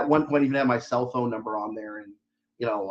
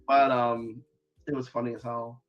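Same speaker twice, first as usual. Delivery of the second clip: usual speaking voice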